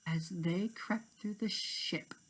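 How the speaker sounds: soft whisper as they creep; whispered narration. A narrator's reading. Narrator whispering the sneaking scene. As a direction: hush it like sneaking.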